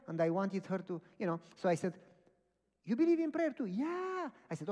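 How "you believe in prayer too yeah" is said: high-pitched mock enthusiasm; excited exchange. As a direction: imitate an eager, high-voiced exchange. Retold excited exchange about prayer.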